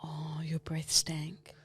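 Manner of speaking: very slow, hushed whisper; mock confidential whisper. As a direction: whisper it, drawing every word out. Voices Shaz's unspoken thought.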